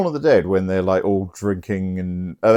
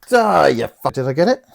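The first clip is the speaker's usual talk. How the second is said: louder, higher angry snap at a fly; real annoyed swat; 7 semitones higher, 4 dB louder than usual. Real, not acted. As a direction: curse the fly as you hit it.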